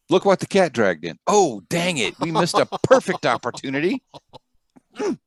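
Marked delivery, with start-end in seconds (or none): none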